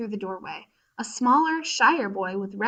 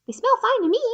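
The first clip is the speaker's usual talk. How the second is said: much higher, louder little bee voice; high worker bee; 13 semitones higher, 5 dB louder, faster than usual. Acted. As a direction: chirp it like a tiny eager bee. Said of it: "said one of Bea's fellow workers".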